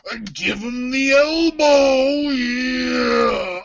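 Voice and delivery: high, slow wrestler roar; Macho Man Randy Savage. Acted. Host drops the elbow on henchman, as Macho Man. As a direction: stretch it into a triumphant catchphrase. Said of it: "That would be easier than Macho".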